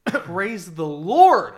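slow, loud, drawn-out praise; jubilant shout. Real, not acted. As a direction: shout it like a hallelujah. Joyful exclamation on hearing the news.

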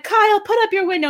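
quick, high-pitched order; high mock-bossy voice; imitated bossy mother. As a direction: mimic a mom ordering her kid, fast and high. Voices the mother ordering her son.

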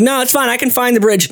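high, light polite refusal; polite brush-off. Retold or imitated. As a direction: turn him down kindly. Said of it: "She's like".

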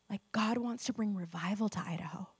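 hushed prophetic whisper; quiet unvoiced declaration. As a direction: breathe it like a secret promise.

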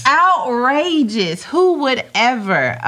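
slow, drawn-out mock indignation; mock outrage. Host scoffing at burpees replacing snacks, mock outrage. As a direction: stretch it out, playfully scandalized.

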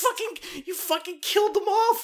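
squeaky, very high put-on voice; mocking an ad in a shrill falsetto; outraged mock voice. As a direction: shriek it up high like a cartoon.

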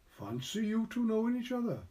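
said loudly. A narrator's reading. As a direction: call it out brightly at a high pitch. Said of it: "he said loudly".

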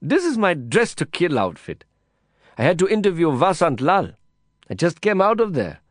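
a hint of the brash reporter, embarrassed; Kavita's voice. A narrator's reading.

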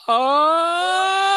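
sudden, very high, loud villain shriek; a gleeful comic-book villain yelp; Riddler. Retold or imitated. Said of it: "Like Riddler style".

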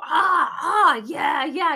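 loud, high gym-crowd shouts; imitated crowd yell. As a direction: shout like fighters hyped up mid-class. Crowd yelling in boxing class.

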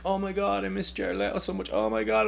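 mock gushing fan mourning; mocking fans posting about the dead star; mock fan grief. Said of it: "people posting, like".

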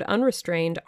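ordinary delivery, nothing marked

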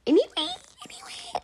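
squeaky high sing-song repeat; playful squeak on a throwaway word; silly high voice. Pretend. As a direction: toss it off in a goofy high-pitched voice.

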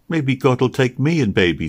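put-on a child thinking aloud; a hint of a pensive young boy; thoughtful little boy. A narrator's reading. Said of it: "said Robin thoughtfully".

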